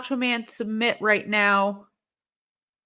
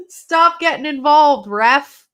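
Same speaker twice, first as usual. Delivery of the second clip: yelling at the ref; 5 semitones higher, 9 dB louder, slower than usual. Real, not acted. Yelling at the referee on screen.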